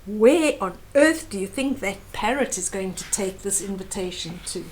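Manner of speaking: plain reading voice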